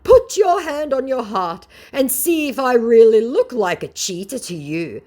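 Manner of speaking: high, loud pleading scammer; mock scammer voice. Vlogger mocking the scammer's plea, high and loud. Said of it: "the scammer said".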